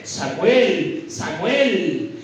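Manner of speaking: low, drawn-out call of the name; voice of God calling. Acted. God's voice calls young Samuel, low and drawn-out calling. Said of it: "cuando llega la voz y le dice".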